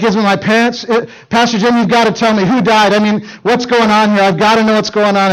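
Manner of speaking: imitating a frantic wife begging for news; wife pleading with the pastor in retold panic. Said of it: "she lost it".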